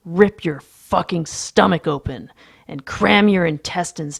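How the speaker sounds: loud, vicious snarl; snarling, spat out. A narrator's reading. Narrator threatens to gut Lewis, snarling. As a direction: spit out the threat with rising fury. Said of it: "snarling, I spit out".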